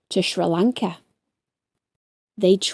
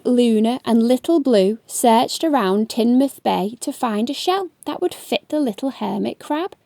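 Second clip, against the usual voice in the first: plain reading voice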